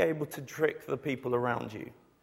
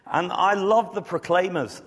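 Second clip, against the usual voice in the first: hammed-up Scottish brogue, higher and louder; fake Scottish accent; 5 semitones higher, 6 dB louder than usual. Preacher to bouncers, hamming a fake Scottish accent. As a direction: oversell being Scottish to the bouncer. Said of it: "I'm gonna try anyway".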